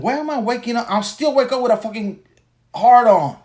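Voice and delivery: high, puzzled exclamation; baffled outburst. Retold or imitated. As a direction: exclaim in disbelief. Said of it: "I'm like".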